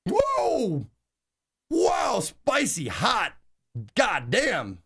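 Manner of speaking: spice-shocked reaction. Retold or imitated. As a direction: act out being floored by a fiery bite. Retells first bite of very spicy food.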